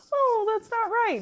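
high-pitched mock protest; put-on dainty, high voice of disapproval; mocking objection. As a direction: mimic a squeamish objector. Mimics objectors, then answers them.

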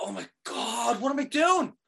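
spiral into horrified disbelief; panicked dismay. Retold or imitated. Realizing it was a wheelchair.

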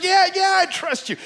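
loud, high, eager reply; desperate shouted answer. Acted. Man on the branch assures God, shouting. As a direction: play a man agreeing in desperation.

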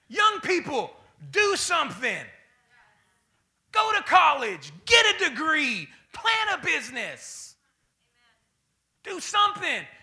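high, loud preaching call; shouted exhortation. Acted. Preacher shouting advice to young people. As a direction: drive it home to the young.

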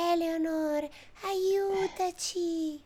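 very high, slow, soft ghost voice; ghostly whisper-plea. Pretend. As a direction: call out like a faint spirit. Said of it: "fantasmini che le dicono".

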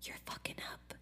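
the universe's faint whispered nudge; universe whispering. Pretend. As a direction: breathe the warning like a secret. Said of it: "starts with a whisper".

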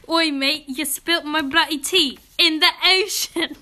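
hammed-up British accent. Shown as a demonstration. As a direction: put on a mock British accent. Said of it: "do a British accent first".

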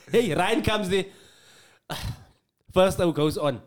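ordinary talk

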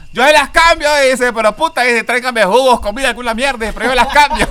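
loud, high retold shout from the cell; imitated yell, high and loud; calling out. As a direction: shout an eager offer, hammed up for laughs. Inmate calls out from inside cell.

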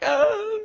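high-pitched "oh" of fright; hammed-up squeal of alarm; mock freaked-out cry. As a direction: yelp like someone spooked by a snake. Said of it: "I was just freaked out".

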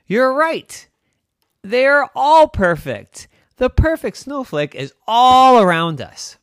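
high, loud exclamation with drawn-out words; awed excitement. Acted. Captain realizes the snowflakes are all around, awed excitement. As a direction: marvel in a big, high voice, stretching it out.

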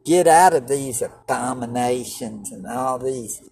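ordinary talk

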